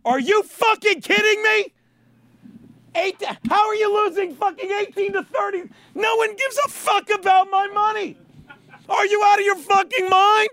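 high, loud outburst of disbelief; outraged yelling. Real, not acted. Gambler raging at the score of his bet, outraged yell.

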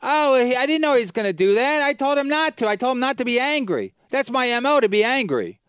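high, loud, whiny put-on; mock the host's excuse in a whiny tone. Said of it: "and then you'd be like".

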